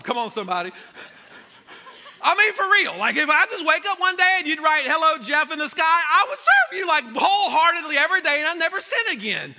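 loud, high, building sermon cry; rising to a shouted climax; full-force preaching. Acted. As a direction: preach it to the back row.

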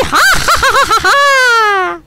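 high, shrieking cackle, trailing out long; gloating laugh. Real, not acted. Gamer gloating over opponents after the goal, shrieking laugh. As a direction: cackle at the top of your voice.

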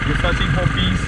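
normal talking voice

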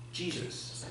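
breathy whisper of "Jesus", barely voiced; whispered answer. Real, not acted. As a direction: drop to a hushed whisper on "Jesus". Preacher answers own rhetorical questions.

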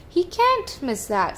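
high-pitched storybook protest; crying out. A narrator's reading. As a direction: cry it out high as a protesting child character. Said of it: "Sam cried".

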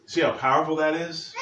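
normal talking voice

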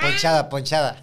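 laughing. Real, not acted. Laughing about flat tire.

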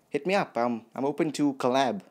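ordinary talk

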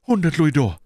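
high, loud answer; frightened bandit. Acted. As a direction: blurt out the sum as the captive. Captive bandit names his payment.

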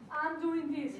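urging, emphatic model for the audience; emphatic declaration. Shown as a demonstration. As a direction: declare it loudly to rally the room.

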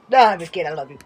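ordinary talk, nothing marked

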